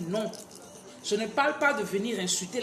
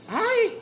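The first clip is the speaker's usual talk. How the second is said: aïe; 8 dB louder than usual. Real, not acted. Exclamation soudaine pendant le direct.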